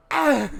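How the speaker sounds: short high shout; mock yell. Joking about throwing a drink, mock yell.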